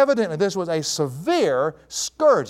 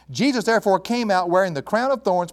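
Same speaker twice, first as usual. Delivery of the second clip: normal talking voice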